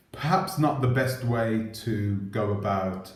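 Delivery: ordinary talk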